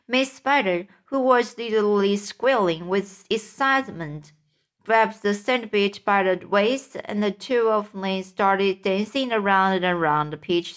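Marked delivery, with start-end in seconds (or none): none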